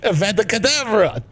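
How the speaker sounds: put-on hammy Yiddish accent villain. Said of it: "him being like".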